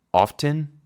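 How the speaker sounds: crisp often, T clearly heard; T pronounced. Shown as a demonstration. Teacher repeats the T form. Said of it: "¿O escuchas".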